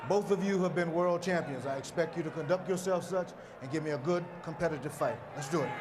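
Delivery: ordinary talk, nothing marked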